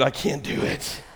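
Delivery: ordinary talk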